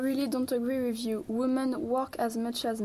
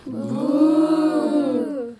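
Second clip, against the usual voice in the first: long, loud drawn-out boo; 3 semitones higher, 10 dB louder than usual. Acted. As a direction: jeer the opponent with a swelling boo. Debaters jeer the insult to women.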